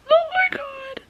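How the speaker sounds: squealing with real excitement; excited squeal. Real, not acted. Squealing with excitement before gala.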